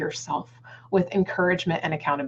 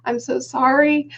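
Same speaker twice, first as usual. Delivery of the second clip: higher, slow apology to the neighbors; hammed-up sorry to the neighbors; a mom apologizing to neighbors for her screaming daughter, mock sheepish; 6 semitones higher, slower than usual. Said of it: "and I'm like".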